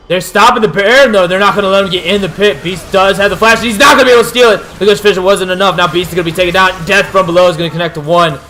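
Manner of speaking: loud, fast, rising play-by-play call; excited shouting. Real, not acted. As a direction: call the fight at full volume. Team fight at Baron, caster yells.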